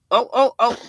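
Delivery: normal talking voice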